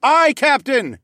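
loud, high crew response; full-voiced acknowledgement of an order; shouting. A narrator's reading. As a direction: shout back like a crew as one. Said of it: "we shouted as one".

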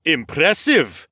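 slow, bright praise called out; cheerful call. Acted. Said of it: "Nikolaus cheerfully called out".

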